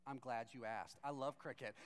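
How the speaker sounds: low, earnest reply; mock serious. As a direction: say it gravely, as a solemn confession. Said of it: "with a, like, really serious look".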